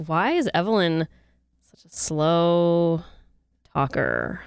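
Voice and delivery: drawn-out, slow words; slow talker. Retold or imitated. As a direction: stretch each word slowly. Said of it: "I made a real effort to talk slow".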